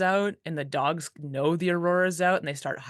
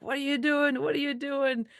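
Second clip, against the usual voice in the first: much higher exasperated scolding; mock-scold goofing dogs in a high voice; 10 semitones higher than usual. Musher scolding her goofing prime dogs, high exasperated. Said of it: "I'm like".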